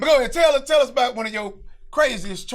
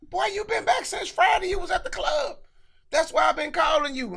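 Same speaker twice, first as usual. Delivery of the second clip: put-on accusing girlfriend; 9 semitones higher than usual. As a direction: throw the accusation at him, pitched high. Girlfriend accusing him in church.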